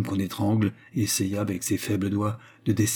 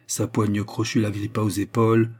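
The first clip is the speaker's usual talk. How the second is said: usual speaking voice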